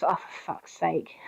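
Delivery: weary under-the-breath groan; exasperated mutter. Retold or imitated. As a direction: let the exasperation drain out quietly. Exasperated retold reaction.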